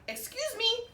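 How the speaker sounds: a tiny voice calling out; little voice. A narrator's reading. The little blue bird calling to them, small and high. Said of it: "a little voice called out".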